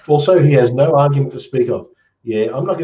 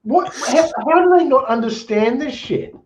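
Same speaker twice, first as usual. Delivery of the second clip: incredulous; 13 semitones higher than usual. Real, not acted. Reacting to cat becoming human claim.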